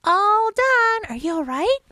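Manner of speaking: high, loud cheerful fish; Boyfish voice. Acted. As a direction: chirp it out brightly. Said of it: "I'll do the Boyfish".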